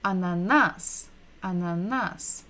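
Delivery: "Ananas" as one word, stressed on the last NAS; stress on final syllable. Shown as a demonstration. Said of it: "we're stressing the final syllable".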